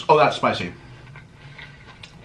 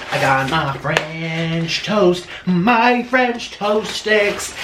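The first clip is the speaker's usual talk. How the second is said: slow drawn-out; 2 semitones higher, much slower than usual. Pretend. Drawn out food announcement.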